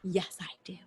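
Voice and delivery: breathless whispered answer; whispered yes. Retold or imitated. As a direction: whisper it like a secret thrill. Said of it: "And I was like".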